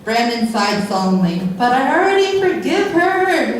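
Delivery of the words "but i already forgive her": high, hammed-up whine; whining. A narrator's reading. Brandon protesting to his friend about Amber, whining. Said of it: "he whined".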